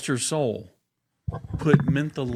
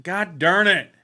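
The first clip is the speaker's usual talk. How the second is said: louder, higher exasperated "God darn it"; frustrated curse; 6 semitones higher, 6 dB louder than usual. Real, not acted. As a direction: snap at yourself for fumbling. Fumbling the tongue twister again.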